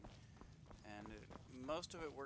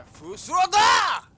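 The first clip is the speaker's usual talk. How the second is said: booming, much louder shout; 28 dB louder, much slower than usual. Acted. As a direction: bellow the shout out. Acting out in-game shout.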